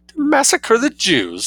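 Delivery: outraged disbelief, drawn out; indignant. A narrator's reading.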